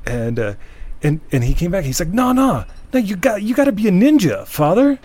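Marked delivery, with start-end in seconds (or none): 2.1-5.0 high, loud impassioned plea; urgent insisting. Retold or imitated. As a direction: imitate a frantic man insisting. Homeless man urges priest, retold.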